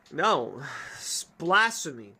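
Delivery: ordinary talk, nothing marked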